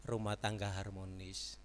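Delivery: usual speaking voice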